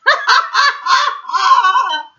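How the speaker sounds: loud, high crazed laughter; Man crashing through jungle laughing; madman laugh. A narrator's reading. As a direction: cackle like a madman. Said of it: "laughing like a madman".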